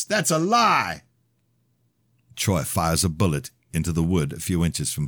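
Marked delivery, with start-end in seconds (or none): 0.0-1.0 high, slow outraged shout. Acted. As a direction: shout him down. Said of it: "Father shouts".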